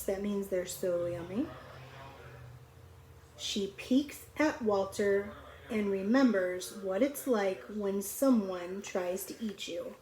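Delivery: ordinary delivery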